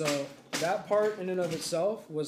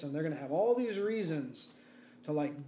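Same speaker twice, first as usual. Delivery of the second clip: usual speaking voice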